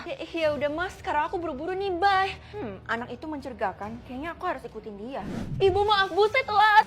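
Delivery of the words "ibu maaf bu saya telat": breathless apology. Acted. Rushing in late, panting.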